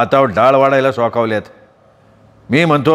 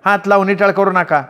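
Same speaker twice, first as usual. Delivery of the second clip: higher, sharp scolding sister-in-law voice; snapping elder sister-in-law; 7 semitones higher than usual. Acted. Elder sister-in-law forbids Radha from touching food, snapping. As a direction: crack it out like a haughty in-law. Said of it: "कडाडली".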